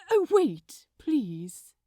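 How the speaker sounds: ordinary delivery, nothing marked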